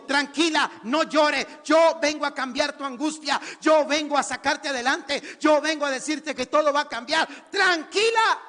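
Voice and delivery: loud, building reassurance ending in a shout; emphatic declaration. Acted. Jesus promising the woman change, loud emphatic assurance. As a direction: declare it forcefully, climaxing high.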